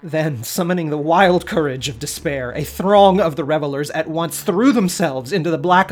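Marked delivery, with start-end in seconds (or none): none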